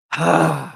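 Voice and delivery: big exasperated sigh, falling; frustrated sigh. Shown as a demonstration. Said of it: "like a really frustrated sigh".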